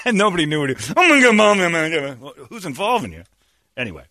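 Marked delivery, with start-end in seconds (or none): 1.0-1.6 loud, high mock declaration; mock outburst. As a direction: blurt it out like a joke threat. Host jokingly mocks himself.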